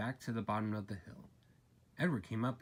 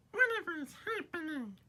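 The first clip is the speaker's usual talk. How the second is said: very high, squeaky bewildered cry; startled freight cars. A narrator's reading. Bumped freight cars crying out in confusion. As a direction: squeal it like a jolted little wagon.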